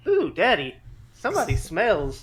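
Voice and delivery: put-on high, loud sing-out of a kid to Daddy; imitated child. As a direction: mimic a child loudly announcing a stink. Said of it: "a child walked in and goes".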